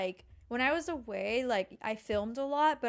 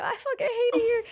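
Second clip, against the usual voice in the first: very high, loud frustrated cry; exasperated outburst; 13 semitones higher, 7 dB louder than usual. Retold or imitated. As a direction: vent the frustration in a squeal. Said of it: "I'm like".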